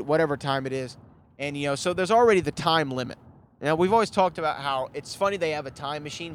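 ordinary talk, nothing marked

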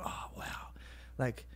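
whispered, awed thought; hushed awe. Retold or imitated. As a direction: let it out softly under the breath. Recalls awed thought watching films.

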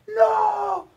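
very high, drawn-out wail; mock dying cry. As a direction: stretch out a thin, high no. Acts out a last cry.

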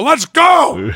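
high, hollered urging; impatient rallying yell; egging him on. Retold or imitated. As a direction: push the doctor onward, pitch shooting up. Retold patient egging doctor on.

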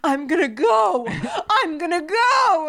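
high, loud, drawn-out mock determination; pumped-up mock shout. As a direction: belt it out slowly like a hyped gym-goer. Acts out pumped-up reply to coach.

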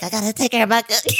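put-on quick, babyish little voice; mocking baby talk. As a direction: lisp it like a baby. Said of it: "like, in her baby voice".

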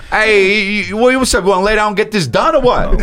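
a pushy Jersey chick coming on strong; hammed-up New York accent; mock Jersey girl. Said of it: "You don't wanna go like".

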